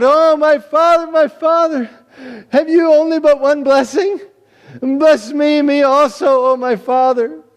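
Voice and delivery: loud, high tearful begging; weeping cry. Acted. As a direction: wail for a blessing through tears. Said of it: "wept and cried out".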